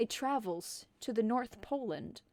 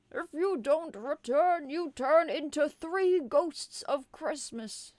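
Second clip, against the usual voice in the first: warning Santa; 5 semitones higher, 5 dB louder than usual. Acted. Santa threatens elf.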